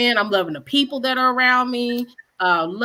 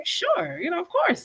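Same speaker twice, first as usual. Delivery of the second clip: high, bright eager agreement; eager yes; 10 semitones higher than usual. Retold or imitated. Chef retelling accepting the article offer, eager high yes. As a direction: jump at the offer. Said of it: "And I'm like".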